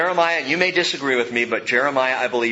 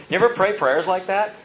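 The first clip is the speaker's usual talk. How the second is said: normal talking voice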